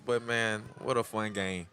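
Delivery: normal talking voice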